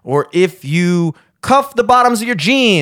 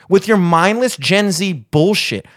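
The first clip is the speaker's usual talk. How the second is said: grumpy rant; 7 semitones higher than usual. Acted. Mock grumpy old-timer rant.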